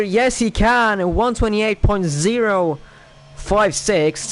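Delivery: slow, punched triumphant yes; excited commentary. Real, not acted. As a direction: savour the pole lap time. Driver takes personal pole.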